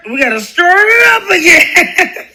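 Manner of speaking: high, drawn-out holler dissolving into laughter; laughing, stretched-out rallying cry. Real, not acted. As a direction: belt it out slowly and crack up. Rallying viewers, breaking into laughter.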